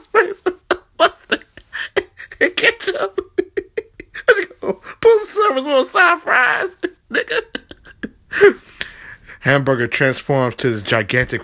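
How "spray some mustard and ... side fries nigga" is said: high, breaking into laughter; laughing. Real, not acted. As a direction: talk through the giggles. Quoting while laughing.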